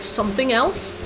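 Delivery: ordinary talk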